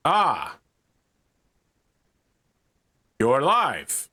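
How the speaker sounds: hero's greeting with high, swooping pitch peaks; Space Marine. Acted. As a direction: play a towering armored warrior finding a survivor. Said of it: "who goes".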